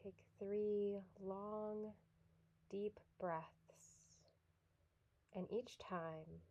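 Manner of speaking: soft, unhurried yoga nidra guidance with long pauses; guided meditation. Real, not acted.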